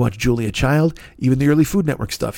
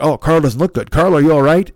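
louder put-on narrator playing up fake tension; mock dramatic voice-over; 5 dB louder than usual. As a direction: pump up the fake suspense. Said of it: "they'll be like".

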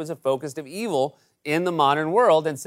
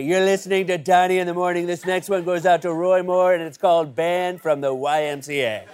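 morning radio DJ announcing a song. Pretend. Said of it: "we have a radio D J".

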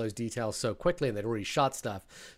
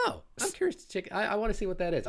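mock curious; 8 semitones higher, 3 dB quieter than usual. Retelling his own curious thought.

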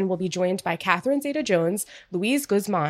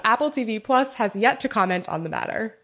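ordinary talk, nothing marked

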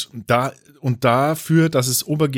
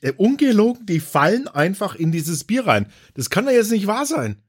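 excited disbelief; 9 semitones higher than usual. Real, not acted. Outraged at fruit flies in beer.

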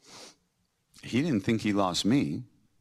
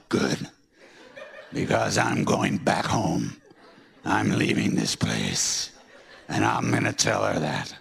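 defiant retort; 9 semitones lower, slower than usual. Retold or imitated. Angry retort about leaving.

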